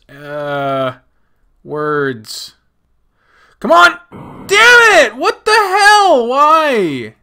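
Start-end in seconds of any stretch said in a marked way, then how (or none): 3.6-7.1 high, loud wail of protest; exasperated yelling. Real, not acted. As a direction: cry out at an unfair game. Fails in the game.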